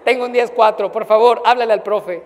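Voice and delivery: loud, laughing radio report, proud of it; playing at being a security guard on the radio; mock radio call. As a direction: bark into the radio like a guard on patrol.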